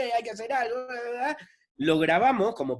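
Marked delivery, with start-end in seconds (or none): 0.1-1.4 a higher, half-hearted imitation of a crowd protester yelling; mock crowd shout. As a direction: toss off a protester's yell, only lightly raised. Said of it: "yo mismo también gritando distintos insultos". (imitación ligera de un grito de protesta)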